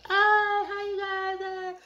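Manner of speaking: high, loud, bubbly hello; overexcited greeting. Pretend. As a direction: burst out with a hyper greeting. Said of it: "get too excited and be like".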